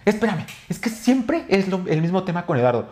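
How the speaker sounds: high-pitched exasperated complaint, retold; imitated irritated uncle. Nephew re-enacting his uncle's retort to the girlfriend, mock exasperation. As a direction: mimic an uncle fed up with the topic. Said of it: "él estaba así".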